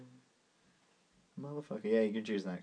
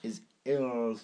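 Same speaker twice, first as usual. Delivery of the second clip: posh voice. Shown as a demonstration. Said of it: "in a very posh voice".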